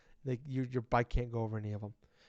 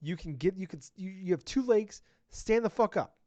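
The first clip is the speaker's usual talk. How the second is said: retold yelling; 5 semitones higher than usual. Player scolding Mama in the game, high retold yelling. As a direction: bark orders at a useless passenger. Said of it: "I'm like".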